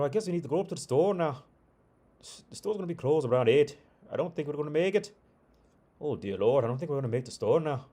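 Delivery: high, quick, hammed-up Scottish accent; shitty Scottish accent. Old couple fretting about reaching the store, mock Scottish accent. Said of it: "my shitty Scottish accent".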